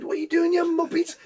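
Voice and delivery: high-pitched self-scolding; mock alarm. Scorer catching himself running at rival fans, high mock alarm. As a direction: squeak it in comic disbelief.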